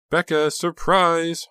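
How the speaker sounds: high, loud, slow gleeful call. A narrator's reading. Honey greeting Becca, calling out gleefully. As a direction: sing it out with delight. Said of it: "Honey called gleefully".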